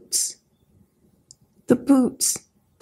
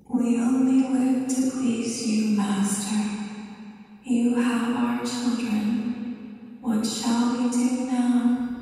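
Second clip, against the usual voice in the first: soft, slow, distant intoning; entranced servile couple; 6 dB quieter than usual. Acted. Possessed couple asking the Scarecrow for orders, eerie and subservient. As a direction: address the master softly, as if possessed.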